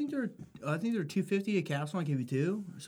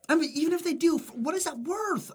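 high-pitched disbelief; incredulous rant; 11 semitones higher than usual. Real, not acted. Guy arguing bikini car washes are pointless, incredulous. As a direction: protest it in a high, exasperated voice.

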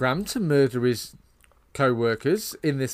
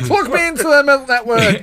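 loud, high laughing robot line; 8 semitones higher, 9 dB louder than usual. Acted. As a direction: demand it like a giddy robot.

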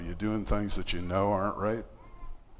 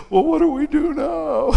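high, wailing imitation of a weeping man; mock crying plea; 6 dB louder, slower than usual. A preacher mimics a weeping couple, crying plea. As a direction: play the sobbing sinner, pitched way up. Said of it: "they're bawling".

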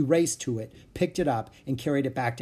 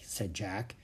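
usual speaking voice

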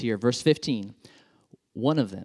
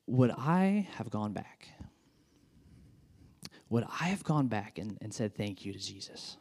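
quiet reflection; 3 semitones lower, 8 dB quieter than usual. Real, not acted. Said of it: "reflective self-question".

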